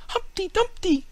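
high loud shout of a title; children crying. A narrator's reading. Children shouting for their favourite story, high and loud. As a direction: shout your pick like an eager child. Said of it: "cried the others".

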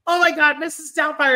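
high, loud starstruck gasp of recognition; excited recognition. Retold or imitated. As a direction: blurt it out like meeting a hero. Fan recognizing actor, retold.

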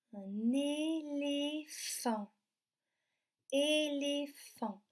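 slow, stretched "éléphant"; syllable by syllable. Shown as a demonstration. Teacher naming the animal for children, slowly syllabified. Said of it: "est-ce qu'on entend le son P".

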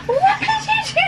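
high surprised squeal; shocked laughing question. Real, not acted. Baby did something.

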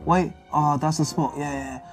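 normal talking voice

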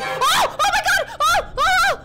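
high, loud screams breaking into laughter; jump-scare squeals and gasps; real startled shrieking. Real, not acted. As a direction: shriek in fright, laughing helplessly. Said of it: "That actually scared me".

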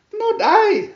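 very high, loud meowing refusal; cat meow voice. A narrator's reading. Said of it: "meowed the cat".

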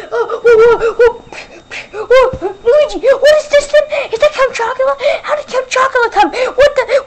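high, loud, flustered shouting; panicked surprise. Acted. Luigi startled by Count Chocula appearing. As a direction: freak out at the sudden vampire.

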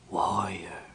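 low, soft ghostly whisper; cold spectral voice. A narrator's reading. A spectre addresses Caramon from the darkness, cold whisper. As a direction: whisper it coldly, close to the ear. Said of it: "said a cold voice almost in his ear".

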